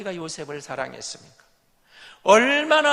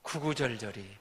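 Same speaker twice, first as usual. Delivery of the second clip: ordinary delivery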